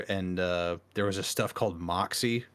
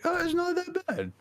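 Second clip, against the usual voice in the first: pleasantly surprised. Retold or imitated. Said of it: "I was like".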